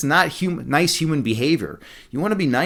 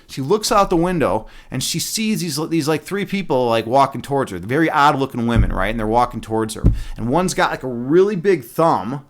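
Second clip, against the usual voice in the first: normal talking voice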